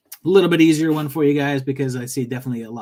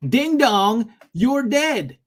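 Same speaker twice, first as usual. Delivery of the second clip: sing-song doorbell voice; 9 semitones higher, 6 dB louder, much slower than usual. Acted. Tagline read high and loud.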